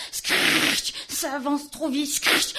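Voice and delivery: loud, breathy, toneless bursts; hissed alarm cries. Acted. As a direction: spit the word out as danger nears.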